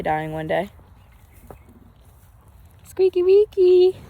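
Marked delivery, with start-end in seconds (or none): 2.8-4.0 cooing pet name. Real, not acted. Calling her pet cow sweetly.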